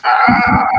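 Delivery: high, loud drawn-out scream; pained cry. Acted. Stabbed captain lets go of the box, pained cry. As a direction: cry out long and high in pain.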